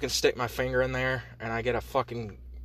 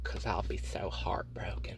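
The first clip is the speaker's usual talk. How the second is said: mock heartbroken whisper; 5 semitones lower, 8 dB quieter than usual. Mock self-pity after crying.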